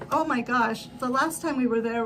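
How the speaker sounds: ordinary delivery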